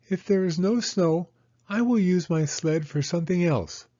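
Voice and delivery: put-on high, eager child's voice; little girl Penny. Penny asking Mama about snow, high childlike voice. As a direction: ask like an impatient little girl.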